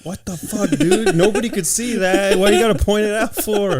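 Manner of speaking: high, outraged squeak of protest; embarrassed protest. Real, not acted. Man squeaks in outrage at his podcast cohost. Caught out about sock hole.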